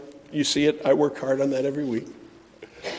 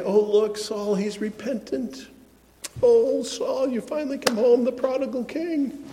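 mock gushing; 7 semitones higher, 3 dB quieter than usual. Mocking naive admirers of Saul.

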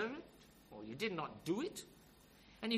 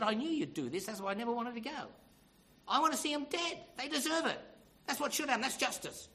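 higher, indignant outburst from a sulking prophet; angry with God; 8 semitones higher, 3 dB louder than usual. Retold or imitated. Jonah complains to God about sparing the Ninevites, acted angry. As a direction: imitate an angry complaint, pitched high. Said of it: "he gets angry with God".

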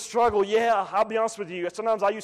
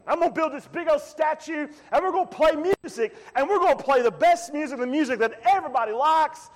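higher, hammed-up party promoter pitch; boastful king; 7 semitones higher than usual. Nebuchadnezzar selling his statue party to the people, hammed-up. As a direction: sell the party like a cheerful huckster. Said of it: "That's what Nebuchadnezzar's saying".

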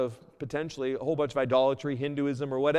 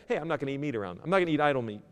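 ordinary delivery

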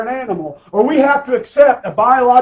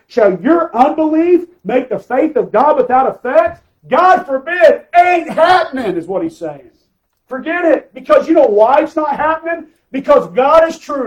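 high-pitched, emphatic pulpit delivery; forceful preaching; 6 semitones higher, 4 dB louder than usual. Acted. Preacher telling the congregation what God says, forceful. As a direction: drive the verse home to the crowd.

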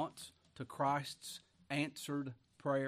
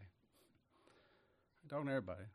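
softer, dropped repeat; quiet aside; 6 dB quieter than usual. Real, not acted. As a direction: say it again under your breath. Repeated aside, drops away.

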